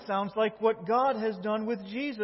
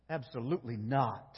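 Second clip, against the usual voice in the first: ordinary delivery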